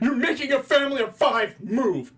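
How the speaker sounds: high, loud indignant protest; mock outraged father. Father protesting being moved from seats, mock outrage. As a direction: shout it like a wronged parent.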